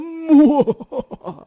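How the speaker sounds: high loud burst of laughter. Real, not acted. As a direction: cracks up at his own bit. Laughing after racist parody.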